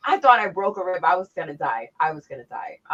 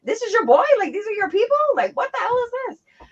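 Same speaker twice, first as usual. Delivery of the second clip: much higher, louder disbelieving questions; incredulous retold outrage; 13 semitones higher, 6 dB louder than usual. Retelling her shock at a coworker's crowd, high incredulous voice. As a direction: pitch it way up in mock disbelief.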